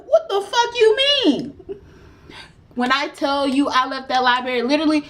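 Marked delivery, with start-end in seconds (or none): 0.1-1.5 high, laughing near-tears outrage; laugh-cry in disbelief at the news. Real, not acted. Mother hearing her son got lost, laughing-crying in disbelief.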